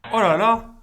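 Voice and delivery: loud, high cry of dismay; exclaiming in horrified disbelief at himself. Real, not acted. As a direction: wail it, caught out by the answer. Dismayed at a wrong answer.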